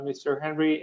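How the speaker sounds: ordinary delivery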